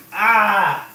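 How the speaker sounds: high "ah" of stinging pain; pained cry. Real, not acted. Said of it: "I'm really crying".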